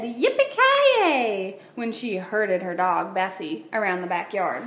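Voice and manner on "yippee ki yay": high, slow drawn-out yippee; cowgirl whoop. A narrator's reading. Alice herding her dog, high drawn-out whoop. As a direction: stretch it like a cowgirl yell. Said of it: "She even said".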